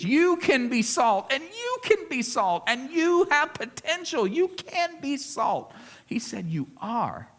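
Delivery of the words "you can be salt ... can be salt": higher, cheesy pep-talk lilt; gushing self-help coach parody; mock motivational speech. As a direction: sell it like a hollow motivational speaker. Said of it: "It's not a motivational speech".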